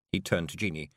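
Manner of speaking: ordinary delivery, nothing marked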